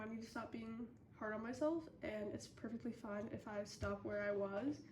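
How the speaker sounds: ordinary talk, nothing marked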